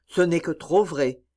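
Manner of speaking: ordinary delivery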